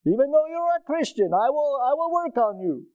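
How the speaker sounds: imitating his Egyptian doctor's accent. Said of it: "But he said".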